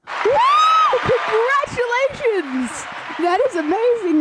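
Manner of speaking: high, loud game show cheer; excited cheer. Acted. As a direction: cheer like a thrilled host. Host cheers correct guess.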